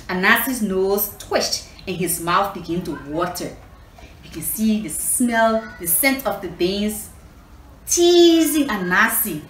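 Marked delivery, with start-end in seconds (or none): none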